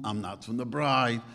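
normal talking voice

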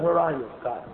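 soft, low question trailing off; quiet searching plea, falling away; plea to God. Acted. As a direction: ask God softly, as one who feels distant. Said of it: "We say to Rebbeinu Shalom".

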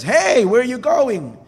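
high, loud, drawn-out call; alarmed shout. Acted. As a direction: holler after someone leaving, slow and high. Wolf calls to departing fox.

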